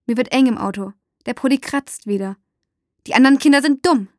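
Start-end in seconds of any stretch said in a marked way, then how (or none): 3.1-4.1 louder, higher, scornful outburst; angry hissing. A narrator's reading. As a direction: spit it out about those children. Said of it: "zische ich". (wütend gezischt, laut)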